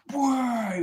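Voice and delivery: high, loud mock shout of "Whoa"; drunken yell. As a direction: bellow "Whoa" like a drunk squaddie. Drunk paratrooper yelling at kid.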